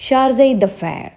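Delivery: ഷാർസൈ ദ ഫെയർ said slowly with stress on ഷാർസൈ and a falling end; careful pronunciation. Shown as a demonstration. Teacher modelling ഷാർസൈ ദ ഫെയർ for students to repeat, slow and clear. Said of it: "ഇതൊന്ന് repeat ചെയ്ത് നോക്കൂ".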